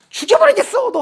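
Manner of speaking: mock furious threat. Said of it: "하는 거야".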